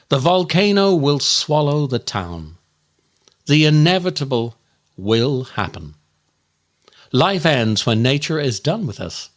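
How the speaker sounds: usual speaking voice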